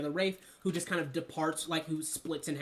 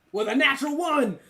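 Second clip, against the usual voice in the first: excited; 8 semitones higher, 10 dB louder than usual. Real, not acted. Announcing a natural one.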